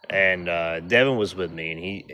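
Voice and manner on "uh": ordinary talk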